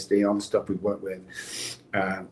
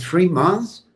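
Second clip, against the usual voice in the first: higher and louder, startled question; shocked disbelief; 9 semitones higher, 7 dB louder than usual. Retold or imitated. Retelling the passport office, shocked at a three-month wait. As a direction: hit it like the news just landed.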